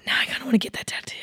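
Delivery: quick, hushed whisper; whispered admission. Real, not acted. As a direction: whisper it fast on the tail of a sigh. Quiet confession after the sigh.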